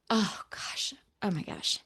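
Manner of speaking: choked-up whisper to self; soft, overcome, nearly crying; close to tears. Real, not acted. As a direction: whisper it, fighting back real tears. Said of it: "I could even start crying thinking about it".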